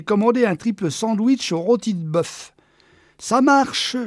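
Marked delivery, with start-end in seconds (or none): 3.2-4.0 high, bright call-out like a waiter; cheery waiter call. Acted. Man playing waiter, calling out his order cheerfully. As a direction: sing it out to a customer. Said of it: "lança-t-il une serviette sur le bras".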